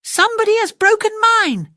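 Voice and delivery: very high, loud squeaky cub voice; tiny bear squeak. Acted. Tiny bear finding his broken chair, high loud squeak. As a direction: squeak like a wounded little cub. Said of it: "Tiny Bear squeaked".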